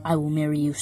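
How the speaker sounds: ordinary talk, nothing marked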